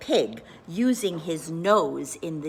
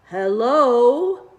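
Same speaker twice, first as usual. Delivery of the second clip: a big, loud, silly hello; loud comic hello; 4 semitones higher, 8 dB louder than usual. A narrator's reading. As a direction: call hello like a cat trying words. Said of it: "let out a".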